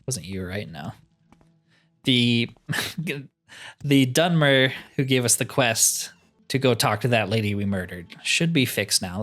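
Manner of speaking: ordinary delivery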